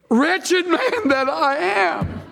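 high, drawn-out cry of exasperation; wretched cry. Acted. Preacher crying out the formula for misery, acted wretched wail.

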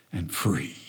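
and free, whispered away. Shown as a demonstration. Preacher ending the hymn line in a whisper. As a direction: breathe and free out voiceless. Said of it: "hymn the church no longer sings".

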